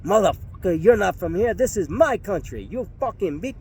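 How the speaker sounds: angry thug. Retold or imitated. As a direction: spit it up high at him. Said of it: "he got pissed".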